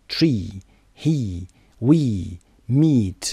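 long ee in Tree, he, we, meet; long vowel. Shown as a demonstration. Teacher drills long ee words for learners, drawn-out. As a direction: draw out each ee, word by word. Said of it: "یه کشیده است".

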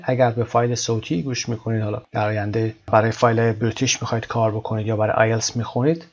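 ordinary delivery

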